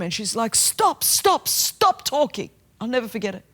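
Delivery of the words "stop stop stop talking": high mock yell, slowing on the last words; retold angry yell. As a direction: echo the girl's furious cry to stop. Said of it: "yelling at me across the room".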